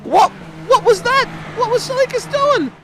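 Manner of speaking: high-pitched, incredulous race call; shocked outburst. Real, not acted. Commentator sees a car run straight on, shocked outburst. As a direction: blurt it out in disbelief, pitch shooting right up.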